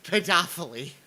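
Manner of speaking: pedophily as "puh-DOFF-uh-lee"; Shakespearean mispronunciation. Retold or imitated. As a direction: savour pedophily as a grand word stressed on DOFF. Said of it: "most Shakespearean way of saying".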